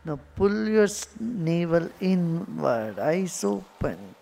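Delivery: unhurried cues with long silences between; guided meditation. Acted. Guru guiding meditators' attention to the belly, guided meditation. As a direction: guide their attention inward, letting words stretch out.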